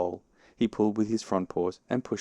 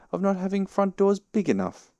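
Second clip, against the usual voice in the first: high, louder indignant complaint; cross; 12 semitones higher, 5 dB louder than usual. A narrator's reading. Pooh blaming Rabbit's front door, cross. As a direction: blame someone in a raised, high voice. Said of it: "said Pooh crossly".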